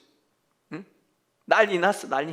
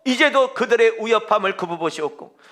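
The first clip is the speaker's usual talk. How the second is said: prayer reading; 3 semitones higher, 4 dB louder than usual. Acted.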